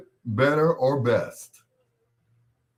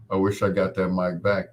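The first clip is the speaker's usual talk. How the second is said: ordinary delivery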